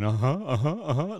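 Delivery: imitating an eager fan, rising and loud; hammed-up keen nodding noises; eager fanboy. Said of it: "just dying for the answers".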